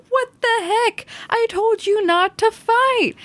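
high-pitched, drawn-out mock outrage; mock angry scolding. Child voicing Washington telling off Hamilton, mock angry scolding. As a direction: scold like an exasperated commander, hammed up.

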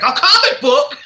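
high, laughing mock surprise; surprised garbage man. Garbage man amazed at the find, high laughing question. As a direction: squeal it out in disbelief.

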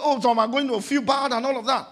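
ordinary delivery

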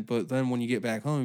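ordinary talk, nothing marked

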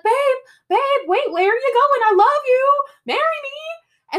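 high, loud hammed-up begging; mock pleading boyfriend. [name] begging Veronica at the car window, high hammed-up plea. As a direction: plead after her like a desperate boyfriend, high.

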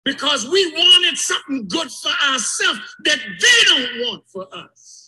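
shouting it out, fired up. Real, not acted. Preacher to the congregation, fired-up shout. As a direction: let it ring out loud. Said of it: "Excuse me for raising my voice".

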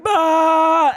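loud high mock bleat; mock yell. Boyfriend lost for an answer, loud mock yell. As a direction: yell a silly high retort. Boyfriend's wordless retort, acted.

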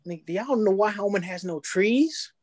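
ordinary talk

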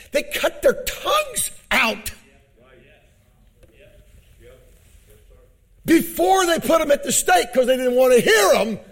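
high, loud preacher's shout; full-force preaching. Acted. Preacher shouting to the church about martyrs.

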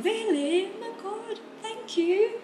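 very high, gushing British reaction; British accent. Shown as a demonstration. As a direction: exclaim sweetly in high British English. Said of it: "aku pasti ngomongnya pakai British accent". (logat British melengking manis)

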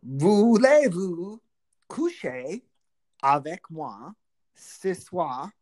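hammed-up French serenade, drawn-out vowels; mock seductive French message; playful French. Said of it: "say something to them in French".

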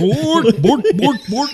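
high, loud mock Swedish Chef babble; hammed-up Muppet chef gibberish; Swedish Chef impression. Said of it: "Like the Swedish Chef?".

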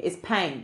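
"paint" with long AY for pink. Shown as a demonstration. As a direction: say "paint" meaning pink, Southern. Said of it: "Not pink. Pink has an I in it".

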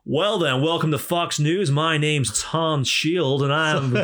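loud, high, slow mock American anchor; American news anchor. Anchor introducing himself on Fox News, mock American voice. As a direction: boom it out like a US newscaster. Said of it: "in America, it's more like".

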